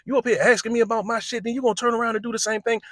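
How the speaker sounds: mock street rant, pushed high; teen firing back at a peer, high fast mock rant; angry teen. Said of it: "this is what Zah".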